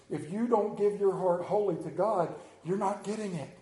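ordinary talk

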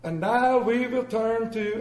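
slow, loud, high preacher voice; mock solemn pastor announcement; pompous pastor. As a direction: announce from the pulpit slowly. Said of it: "and he goes".

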